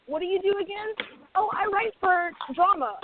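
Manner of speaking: high, lightly voiced meeting chat; tossing the question back and forth lightly; high mock small talk; imitating a meeting exchange. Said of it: "to be like".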